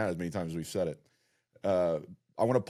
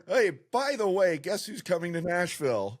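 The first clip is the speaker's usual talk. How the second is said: mock excited announcement; 9 semitones higher than usual. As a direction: pitch it up like breaking big news. Mimics co-host's episode announcements.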